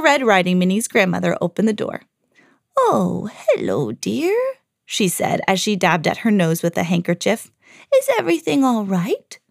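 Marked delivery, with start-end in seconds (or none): 2.8-4.4 lightly voiced granny with a lilt; kindly grandmother. Grandmother with a cold greets Minnie, lightly voiced granny. Real grandmother opens the door. 7.9-9.4 high, lilting granny question; concerned grandmother. Acted. Grandmother at the door checks on Minnie, high granny voice.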